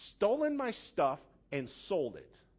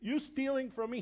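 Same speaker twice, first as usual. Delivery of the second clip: higher, mock-menacing gangster "You steal from me?"; a hint of a Goodfellas mobster; lightly voiced mobster, menacing; De Niro impression; 8 semitones higher than usual. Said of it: "like Robert De Niro in Goodfellas".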